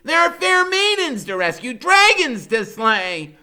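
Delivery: put-on high, loud, grand knightly boy; eager boy hero voice. A narrator's reading. Narrator voicing Freak's morning quest call, high and grand. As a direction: proclaim it like a boy playing knight.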